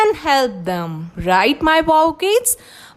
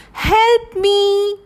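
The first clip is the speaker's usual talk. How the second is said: high, pleading cry for help; pleading lion; 7 semitones higher than usual. Acted. Trapped lion calling out for help, pleading and higher. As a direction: beg for help, a little higher. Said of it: "Lion said".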